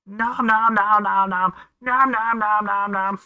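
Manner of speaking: playful chomping chant to a kitten; nom nom chomping. Acted.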